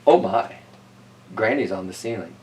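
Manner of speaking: ordinary delivery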